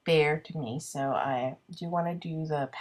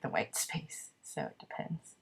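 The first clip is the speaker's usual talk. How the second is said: whispered aside; 4 semitones lower, 8 dB quieter than usual. Real, not acted.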